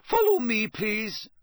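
high, commanding teacher, loud at the start; strict teacher marshalling a line; Professor McGonagall. A narrator's reading. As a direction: call the pupils after you. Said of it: "said Professor McGonagall".

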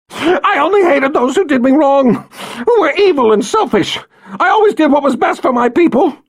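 high frantic shouting rant; crying with rage. Acted. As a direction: rant in desperate fury. Violet ranting desperately.

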